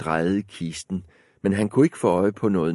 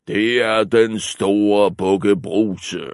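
slow, louder big goat voice; coarse voice; 5 dB louder, slower than usual. Acted. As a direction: answer slow and heavy. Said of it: "med sin grove stemme".

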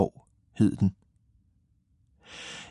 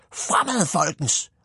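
high-pitched little hero's battle cry; high, eager send-off; rallying call; 6 semitones higher than usual. Acted. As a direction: call them onward in a high character voice. Hero sets off at speed.

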